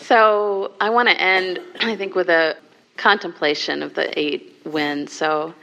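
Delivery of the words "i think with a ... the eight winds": ordinary delivery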